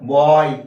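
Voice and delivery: बॉय loud, with its ऑय glide drawn out; diphthong demo. Shown as a demonstration. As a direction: punch out बॉय, stretching the vowel from ऑ to ई. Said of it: "जैसे B O Y". (बॉय ज़ोर से, ऑय खींचकर)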